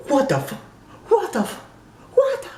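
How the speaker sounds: high-pitched disbelief, climbing with each repeat; mock shock. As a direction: act out a stunned viewer, cutting off short. Acts out viewer's shock at kidnapping.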